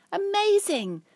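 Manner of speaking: very high, loud excited trill; delighted trill. Acted. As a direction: burst out with joy. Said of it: "Cyril trills".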